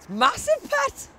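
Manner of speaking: high, bright attempt at a Geordie accent. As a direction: put on a broad Geordie accent. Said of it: "No, you can't do a Geordie accent".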